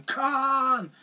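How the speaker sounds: high mock cry of Khan; a hammed-up echo of Kirk's famous shout; Kirk's Khan yell. Kirk shouting his enemy's name in the original. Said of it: "Captain Kirk going".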